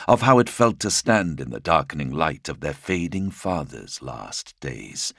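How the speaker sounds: plain reading voice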